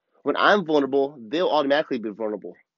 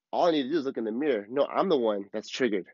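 usual speaking voice

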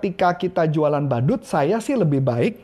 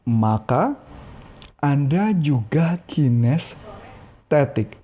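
drawn-out, lower punchline; slow it right down to match them; 5 semitones lower, much slower than usual. Shown as a demonstration. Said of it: "Kalau orangnya kinestetik". (pelan dan rendah)

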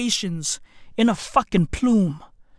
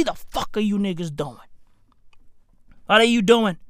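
ordinary talk

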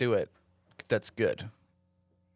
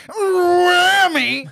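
shouted high sportscaster call; seventies sportscaster; 10 dB louder than usual. Retold or imitated. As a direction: belt it like an old TV announcer. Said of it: "a fucking sportscaster in the seventies".

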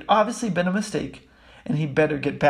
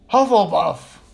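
loud announcing shout; big, carrying call; 3 semitones higher, 7 dB louder, slower than usual. A narrator's reading. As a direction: shout the verdict out. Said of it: "the hat shouted out the house".

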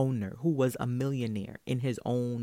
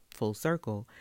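usual speaking voice

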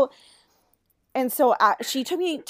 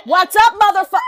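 loud, high, laughing challenge; fighting shout; 9 semitones higher, 13 dB louder, faster than usual. Retold or imitated. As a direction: yell it like you're ready to fight. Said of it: "I'm like".

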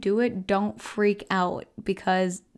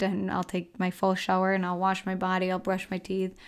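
usual speaking voice

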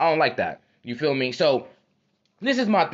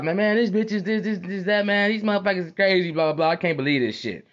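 higher-pitched retold rant to a buddy; acted griping; 5 semitones higher than usual. Venting to his homie about people, acted griping. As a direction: gripe away as if venting.

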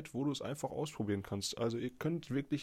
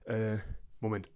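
ordinary delivery

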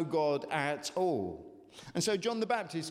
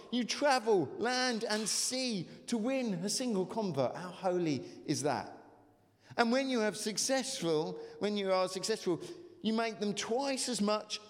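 impassioned scripture reading; 4 semitones higher than usual. Acted. Preacher voicing Jesus' rebuke.

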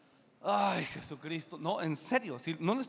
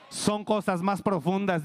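louder, slower repeat of "cosas más profundas"; shouted repeat; 7 dB louder than usual. Shown as a demonstration. Preacher repeating to the congregation, slower and louder. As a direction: drive "Son cosas más profundas" home loudly.

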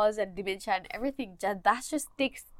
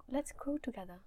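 soft, higher-pitched soothing invitation; 4 semitones higher, 12 dB quieter than usual. Real, not acted. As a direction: say it softly to soothe. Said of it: "Oh, the soothing voice, man".

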